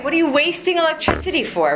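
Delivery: half-hearted retold telling-off; mock yell. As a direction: imitate a grandfather fussing over waste. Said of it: "yell at us as he turned".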